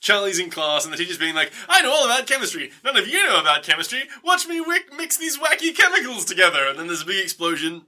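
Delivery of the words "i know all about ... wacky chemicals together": high-pitched, showy teacher voice; boastful teacher. Retold or imitated. Comic chemistry teacher bragging to his pupils, showy. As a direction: show off to the class, pitch climbing.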